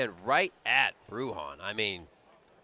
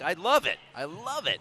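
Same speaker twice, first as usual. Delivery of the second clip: enthusiastic approval; 7 semitones higher, 5 dB louder than usual. Retold or imitated. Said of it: "I'm like".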